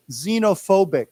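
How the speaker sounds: "Xenophobic" said clearly, starting with z; word modelling. Shown as a demonstration. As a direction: model "Xenophobic" as zee-no-phobic. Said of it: "let's say it as a 形容词".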